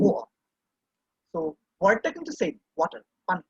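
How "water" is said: "water" in cockney, with the t dropped to a glottal stop, wa'er; cockney "water" with no t and an open end, wa-uh. Shown as a demonstration. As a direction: say "water" as an East London cockney, swallowing the t. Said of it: "if you come to East London, they will say".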